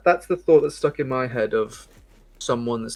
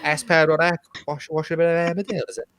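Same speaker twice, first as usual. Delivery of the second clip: put-on high, sing-song Welsh accent; 6 semitones higher, 8 dB louder than usual. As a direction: ask it in a broad Welsh accent. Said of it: "Slipping into my Welsh accent".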